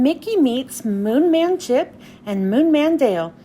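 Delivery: usual speaking voice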